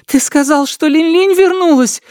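very high, urgent woman's cry; frantic pleading. A narrator's reading. Mother seizes the intruder to ask about her daughter, frantic pleading. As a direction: plead desperately at the top of the range. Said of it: "умоляющим голосом".

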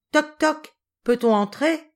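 put-on high, bright little children knocking; childish voices. A narrator's reading. Children knocking at the sick boys' door, high and eager. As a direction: play eager kids asking to come in. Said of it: "dirent une demi-douzaine de voix enfantines".

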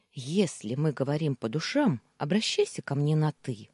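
plain reading voice

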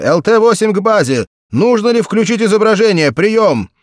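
loud, high pilot radio call; over a pilot headset. A narrator's reading. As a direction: report crisply over the radio.

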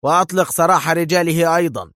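agitated command; nervous. A narrator's reading. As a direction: press on edgily. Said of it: "يستطرد في عصبية".

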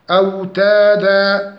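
أوتادا with evenly held long a vowels; chanted أوتادا, measured vowels; natural madd. Shown as a demonstration. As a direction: stretch each long a in أوتادا two beats. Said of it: "أمد مد حركتين".

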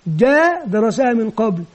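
ordinary talk, nothing marked

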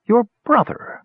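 high, thoughtful voice for the hunchback; lightly voiced sly hunchback; musing villain. A narrator's reading. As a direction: muse it like a sly schemer. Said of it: "mused Pitrick".

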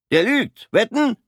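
high, loud gloating jeer; gleefully mocking. Corporal mocks Fritz to the sergeant, high and gloating. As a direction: crow over your own joke.

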